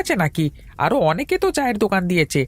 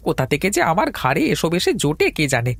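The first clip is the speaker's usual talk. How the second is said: fed-up muttered complaint climbing in pitch; exasperated grumbling; 7 semitones higher than usual. Acted. Tea seller cursing his rotten luck, increasingly exasperated. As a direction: grumble higher and higher until pure irritation.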